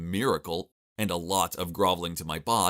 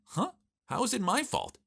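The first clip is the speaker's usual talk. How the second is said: high-pitched, puzzled comeback; indignant confusion, voice leaping up; baffled protest; 13 semitones higher than usual. Acted. Baffled reply to shrieking wife.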